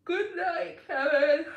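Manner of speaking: words stretched through a yawn. Real, not acted. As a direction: say it mid-yawn, high and loud. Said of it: "I'm yawning".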